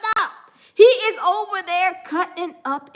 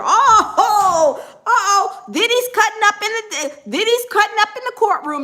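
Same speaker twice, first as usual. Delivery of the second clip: higher, laughing uh-oh over the news; excited gossip; 5 semitones higher, 3 dB louder than usual. Real, not acted. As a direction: spill the tea with delight.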